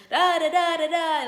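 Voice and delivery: loud angry babble; imitating loud yelling. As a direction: mimic someone shouting nonsense syllables. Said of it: "raise their voice".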